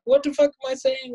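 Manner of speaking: high, strained disbelief at himself; mock panic, a near-squeak; panicked squeak. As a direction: yelp it like you just heard yourself lie. Panicking at his own lie.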